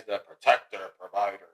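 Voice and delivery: low dad-like protector voice; put-on protector voice. Real, not acted. Father lists what dads are, low put-on voice. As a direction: drop the pitch for the manly provider. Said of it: "Why did you do that voice?".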